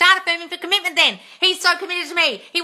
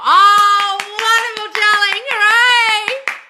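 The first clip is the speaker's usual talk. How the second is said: gushing cheer; 7 semitones higher, 8 dB louder, much slower than usual. Real, not acted. Cheering a viewer's success comment.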